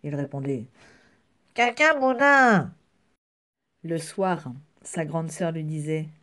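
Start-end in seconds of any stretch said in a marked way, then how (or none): 1.5-2.7 high, loud, drawn-out refusal; defiant cry. Acted. Little rabbit refusing papa's spinach, loud defiant cry. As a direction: throw it back loud and high.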